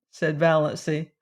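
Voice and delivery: ordinary delivery, nothing marked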